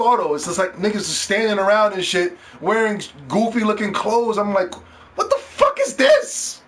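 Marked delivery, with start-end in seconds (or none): 5.1-6.6 very high, slow squeal of disbelief; mock disbelief. Streamer retells seeing players in goofy clothes, high-pitched disbelief. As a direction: stretch it out high, utterly baffled.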